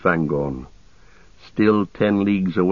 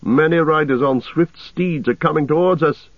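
raised, high-pitched urgent report; crying out; 7 semitones higher than usual. A narrator's reading. Aragorn warns his companions of coming riders, crying out. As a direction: warn them with the pitch lifted. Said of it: "cried Aragorn, springing to his feet".

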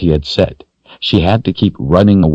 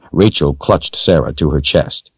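plain reading voice

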